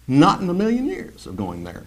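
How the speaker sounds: normal talking voice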